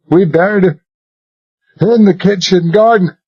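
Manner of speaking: high, stammering, desperate; frightened confession. Acted. Royal confesses the killing to Talbot, fearful. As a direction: blurt it out in fear.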